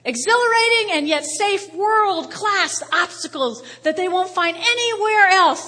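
a mock advert announcer, pumped up; hyped ad read. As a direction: sell it like an overexcited ad. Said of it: "I read, I said".

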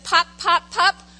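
put-on short, punchy baby syllables; imitating baby babble. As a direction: mimic a one-year-old's babble. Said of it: "and he says".

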